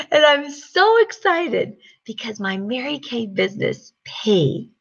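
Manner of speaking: usual speaking voice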